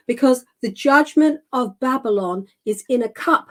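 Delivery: ordinary talk, nothing marked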